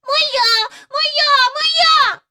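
put-on very high, loud toddler wail; howling. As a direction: howl for the toy. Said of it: "заходилася Барбара".

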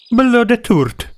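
high-pitched, drawn-out cry; dryad's cry. A narrator's reading. Dryad cries out as the girl bolts, high yelp. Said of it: "зойкнула Дріада".

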